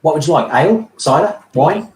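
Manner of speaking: high, eager barman listing drinks; chirpy halfling offering a menu; high-pitched halfling innkeeper; halfling barkeeper. Acted. Barkeeper lists drinks in character.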